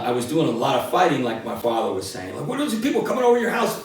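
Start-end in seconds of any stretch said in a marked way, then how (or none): 2.5-3.8 angry dad. Retold or imitated. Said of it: "He was really pissed off".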